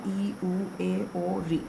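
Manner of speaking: ई, उ, ए, ओ, री said short and soft, mouth barely open; quick little vowels with almost no pressure. Shown as a demonstration. Teacher shows children short vowels said softly. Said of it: "बिना मुंह खोले आप आराम से बोल सकते हैं".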